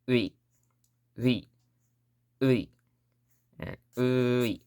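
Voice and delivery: "ui" drawn out, the u held long; slow. Shown as a demonstration. Teacher sounding out a loan word, "ui" slow. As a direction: stretch the "ui" out slowly. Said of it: "if you say it slow".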